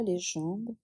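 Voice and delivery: usual speaking voice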